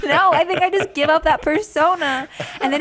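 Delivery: high, loud laughing protest. Real, not acted. Telling co-hosts about giving up her Italian persona, laughing. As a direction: talk through laughter, pitch way up. Joking, laughter heard while talking.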